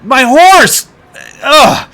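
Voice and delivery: high, loud distressed yelling; carny yelling in distress about his horse and lamb; panicked shouting. Acted. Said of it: "this guy who's shouting right here".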